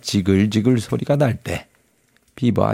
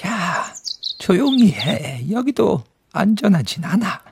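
beaver; 6 semitones higher than usual. Acted. Narrator voices the beaver.

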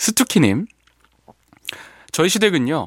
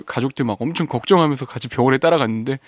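ordinary delivery

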